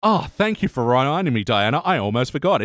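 usual speaking voice

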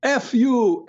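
put-on retelling a kid screaming at him over the phone; mock scream. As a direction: yell it like a rude boy. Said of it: "screamed to me".

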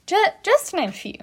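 ordinary talk, nothing marked

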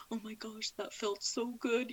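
hushed, lightly tearful retelling; retold tearful relief. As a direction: recall a moved, tearful phone call quietly. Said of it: "I knew he was crying".

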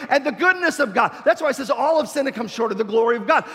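high, loud preacher's cry; shouted preaching. Acted. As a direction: preach it full force. Preaching at full force.

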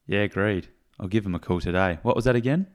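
upbeat scripted sponsor ad; ad read. Acted. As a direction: sell it like a radio spot.

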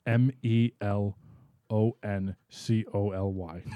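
M E L O N C O L Y, slow letters; spelled one letter at a time, slowly; spelling it out. Shown as a demonstration. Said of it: "Okay, melancholy".

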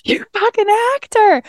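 very high, slow, drawn-out accusation; accusing shriek. Retold or imitated. Confronting the ex on the phone, high accusing shriek.

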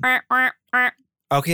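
duck quack. Pretend. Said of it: "that's like a duck".

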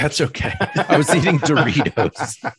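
laughing through the words; giggling, high and loud. Real, not acted. Laughing at co-host's snack confession.

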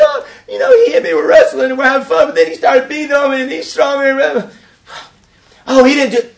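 put-on high, fast child's voice rushing the story; mock whiny little boy. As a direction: tell it like a kid pleading his case. Younger son telling his side.

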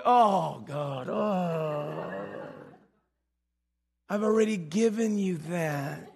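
low, drawn-out groan of mock exasperation; weary Father. Father in heaven answering a needless prayer, wearily exasperated. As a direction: sigh it softly, patience worn thin.

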